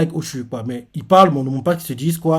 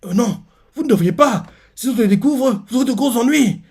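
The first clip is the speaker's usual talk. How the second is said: higher-pitched worried warning; flustered girl; 8 semitones higher than usual. Retold or imitated. Reader voices Ochu warning her suitor, flustered. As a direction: put on a high, hesitant girl's voice.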